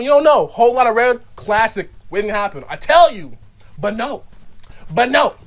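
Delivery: ordinary delivery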